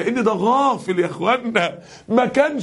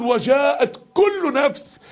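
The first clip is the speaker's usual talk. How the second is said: high, very drawn-out verse; crying-out recitation; 5 semitones higher, much slower than usual. Acted. Preacher voices the surah crying out, high slow cry. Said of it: "بتصرخ بتقول".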